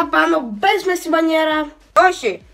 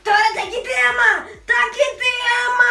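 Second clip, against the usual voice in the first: shouting it twice, higher; excited shout; 8 semitones higher, 4 dB louder than usual. Acted. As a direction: yell it out excitedly. Shouting repeated phrase.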